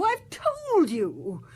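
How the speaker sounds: slow insistence. Acted. Suspect repeating, slowed emphatically.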